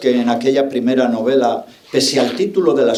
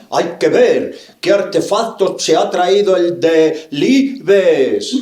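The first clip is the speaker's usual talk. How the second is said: loud, high, drawn-out villagers' outcry; hammed-up town-crier shout, each word stretched; mock shouting; 6 semitones higher, 5 dB louder, slower than usual. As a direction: imitate scandalized villagers yelling, slow and high. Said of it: "Todos gritaban".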